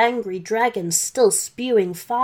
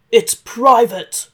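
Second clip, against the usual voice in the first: slow, loud private snap of a dragon; 2 semitones higher, 9 dB louder, slower than usual. Acted. Dragon guarding the stairs from Santa, slow and loud. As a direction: bark it slowly like a guarding dragon.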